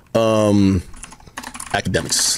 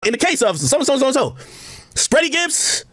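higher, hammed-up talk-show host reading results; mock TV host drama; Doctor Phil; 11 semitones higher, slower than usual. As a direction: announce it like a daytime TV host with a paternity test. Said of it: "I could be the Doctor Phil".

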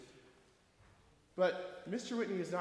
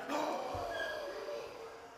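deep breath in; 8 semitones higher, 7 dB quieter than usual. Shown as a demonstration. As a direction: breathe in sharply. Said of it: "deep breath".

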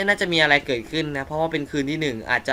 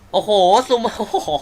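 high, startled wow with a laugh; real startled laugh; 7 semitones higher, 6 dB louder, slower than usual. Real, not acted. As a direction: caught off guard by the zoom, laughing. Startled by the zoom.

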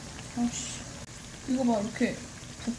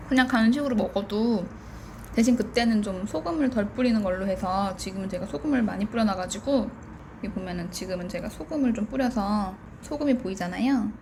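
usual speaking voice

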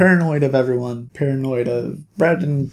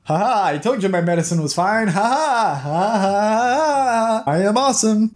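mock gloating; 6 semitones higher than usual. The mayor gloating about his medicine, hammed-up smugness. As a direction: crow it with a long, fake triumphant laugh.

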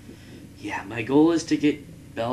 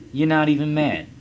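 higher, louder mocking sing-song; mock taunt; 7 semitones higher, 5 dB louder than usual. Taunting defeated Portugal, mock sing-song jeer. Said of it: "I bet you're not even mad now".

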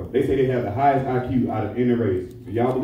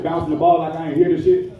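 usual speaking voice

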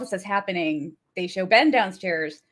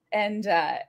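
usual speaking voice

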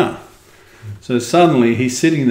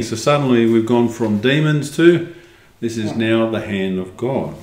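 plain reading voice

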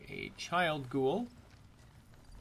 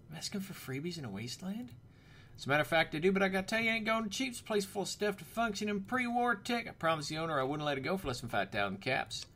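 put-on shopkeeper voice, pitched up; Frankie; 6 semitones higher, faster than usual. Shopkeeper offering a place for sale, put-on higher voice. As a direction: play a storekeeper making a sales pitch.

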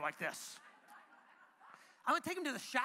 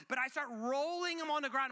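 normal talking voice

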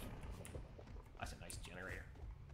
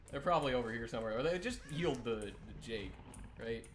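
ordinary talk, nothing marked

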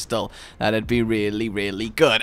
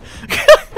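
high laugh; 10 dB louder than usual. Real, not acted. Laugh after pirate talk.

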